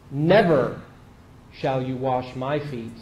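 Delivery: emphatic NEVER, falling to a low, deliberate finish; very strong refusal. Acted. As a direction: hit NEVER hard, then measure out the rest. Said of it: "Very strong".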